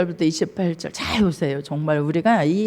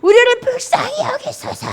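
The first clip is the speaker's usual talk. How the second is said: desperate crying out; 5 dB louder than usual. Acted. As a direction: cry out for mercy with all your might. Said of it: "막 소리를 지릅니다". (높고 간절하게 부르짖는 소리)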